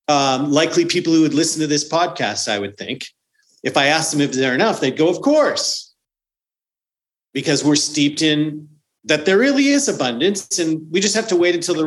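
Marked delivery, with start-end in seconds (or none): 5.1-5.9 breezy mock answer. Imitating listeners' easy answer.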